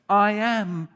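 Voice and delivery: "I am" loud and drawn out, both words weighted; emphatic I am. Shown as a demonstration. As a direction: proclaim "I am" with strong, loud stress. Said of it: "emphatic "I am" statements".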